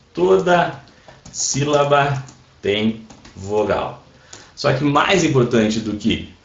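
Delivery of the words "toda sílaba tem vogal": word by word, with gaps; slow dictation. Real, not acted. Teacher typing the rule into chat, dictating slowly. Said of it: "Até vou anotar aqui no chat".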